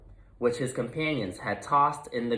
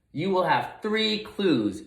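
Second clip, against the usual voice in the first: park ranger; 6 semitones higher than usual. Acted. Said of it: "she said".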